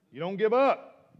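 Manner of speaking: a loud preacher's cry; shouted exhortation. Real, not acted. Preacher shouting to the church not to give up. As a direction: shout it out to the congregation.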